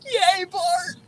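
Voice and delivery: high, squealing sarcastic cheer; mock cheer. Cheering Bart's broken bat single, mock cheer. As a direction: cheer the one hit like it's a big deal.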